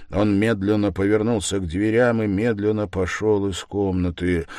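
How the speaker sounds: ordinary delivery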